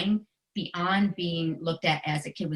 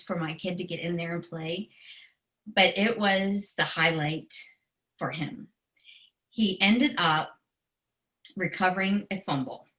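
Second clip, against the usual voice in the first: usual speaking voice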